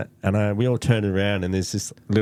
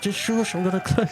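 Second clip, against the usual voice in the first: higher, put-on voice hushing; hushing self-quote; 12 semitones higher than usual. He hushes the women while changing clothes. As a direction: shush them like a naughty kid. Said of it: "I'm like".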